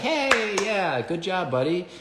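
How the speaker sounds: high, bright praise for a good dog; praising the dog. Shown as a demonstration. Trainer praising the dog as it steps out, in a high, excited voice.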